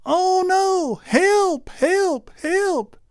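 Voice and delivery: a distant man yelling help. Acted. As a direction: call out in a high panicked voice. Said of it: "heard this voice yelling".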